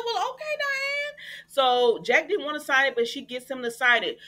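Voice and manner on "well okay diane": much higher, sing-song mock agreement; sarcastic. Host mocking Diane's big speech, high sarcastic chirp. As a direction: roll your eyes and chirp it.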